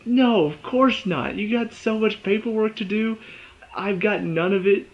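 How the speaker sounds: acted, gloating happiness; gleeful Fornell. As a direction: play someone delighted to be off the hook. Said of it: "he starts laughing".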